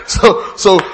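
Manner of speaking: high laugh spilling into words; bursting laugh. Real, not acted. Preacher cracking up again before resuming, real laughter. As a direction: laugh out loud then try to start.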